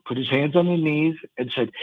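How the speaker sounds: ordinary delivery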